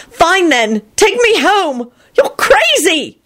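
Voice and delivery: high-pitched angry holler, climbing. A narrator's reading. Elizabeth demanding Lupin take her home, hollering. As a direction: holler it, drawing out the last words. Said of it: "Elizabeth hollered".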